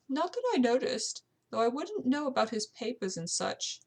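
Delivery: amateur British servant voice; British housekeeper. Acted. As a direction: voice a servant speaking up about her household.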